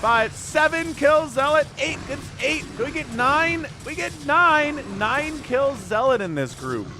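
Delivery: high, loud hype; excited kill count. Real, not acted. Caster counting zealot kills excitedly.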